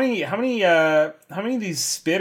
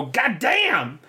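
higher-pitched exclamation, played for laughs; comic holy pronouncement, pitched up; mock divine utterance; 6 semitones higher than usual. Player uttering a divine word with arm raised, mock-grand. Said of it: "I gotta utter a divine word".

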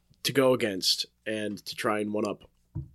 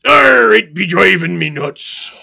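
higher, louder, slow pirate growl; 8 semitones higher, 12 dB louder, slower than usual. Acted. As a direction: ham up a salty pirate. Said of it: "The pirate says".

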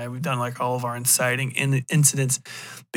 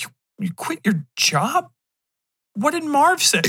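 high voice climbing in disbelief; incredulous girlfriend; 12 semitones higher than usual. Acted. Girlfriend hears he quit his job, incredulous. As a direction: play the girlfriend, pitched right up.

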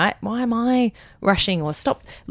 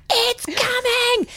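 put-on very high, drawn-out child wail; Wolfie; much slower than usual. Toddler at the checkout who can't hold on, urgent wail. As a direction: imitate a toddler's slow, urgent cry. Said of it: "He goes".

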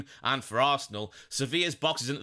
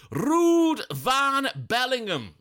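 slow, much higher, louder announcement of Ruud van Bellingham; grand nickname; 10 semitones higher, 5 dB louder, much slower than usual. Real, not acted. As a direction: proclaim the nickname like a title. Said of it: "I'm gonna call him".

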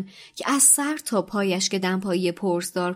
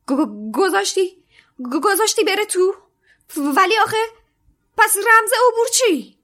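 high, loud stuttering disbelief; shocked stammer; 13 semitones higher, 8 dB louder than usual. A narrator's reading. McGonagall stunned by the knight, stammering shock.

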